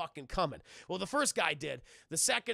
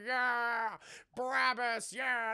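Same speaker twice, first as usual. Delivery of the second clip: mock crowd cheer; 4 semitones higher, much slower than usual. Goro and crowd enjoying the carnage.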